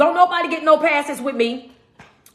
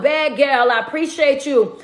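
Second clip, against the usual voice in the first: usual speaking voice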